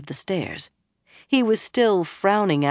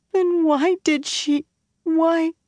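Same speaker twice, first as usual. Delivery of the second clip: tearful quaver; 13 semitones higher, 3 dB louder, slower than usual. A narrator's reading. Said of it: "Her voice quavered ... she was crying".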